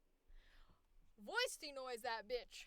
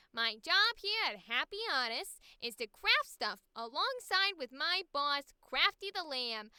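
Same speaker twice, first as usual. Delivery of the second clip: bubbly cheerful character voice; 4 dB louder than usual. Acted. Arlene welcoming a new hire, bright and loud. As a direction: sell the workplace like a sugary greeter. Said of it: "Fran Drescher almost".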